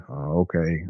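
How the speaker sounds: low, soft, unconvinced reply; eye-rolling skepticism. Retold or imitated. Employee doubting boss's rock salt advice, retold skepticism. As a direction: humor her with an eye roll in the voice. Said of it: "I kinda rolled my eyes".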